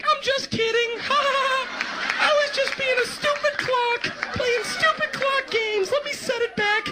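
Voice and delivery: very high, squeaky cartoon clock; talking clock. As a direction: tease like a silly little creature. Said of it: "sprout little legs and be like".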